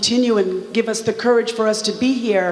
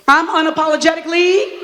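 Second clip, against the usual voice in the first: rally shout; 6 semitones higher, 3 dB louder, faster than usual. Acted. Final rally call.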